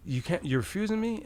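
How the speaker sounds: high, stunned protest; retold shock at the border; disbelief.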